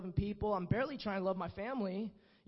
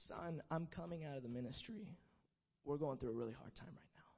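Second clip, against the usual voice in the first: lower, softer, almost whispered through tears; tearful hush; 8 semitones lower, 10 dB quieter than usual. Retold or imitated. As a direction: say it like a father holding back tears. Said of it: "over tears".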